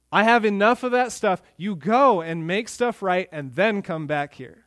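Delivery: high, insistent preaching as a fed-up God; God rebuking worshippers. Acted. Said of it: "He says".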